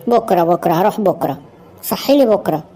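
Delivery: low, drowsy put-off; sleepy pleading. Acted. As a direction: beg off waking up, half asleep.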